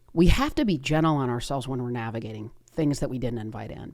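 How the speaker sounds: ordinary delivery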